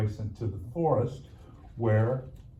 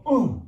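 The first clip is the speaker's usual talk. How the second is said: a high, loud grunt as if swinging the axe himself; mock grunt of effort; 9 semitones higher, 7 dB louder than usual. Companion grunting on the cart as the axe falls, high and loud. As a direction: grunt "Ugh" like the one doing the work. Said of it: "he would go".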